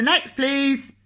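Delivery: loud, high shouted next please; loud call. Acted. Grocer loudly calling the next customer. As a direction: call the next customer over his head.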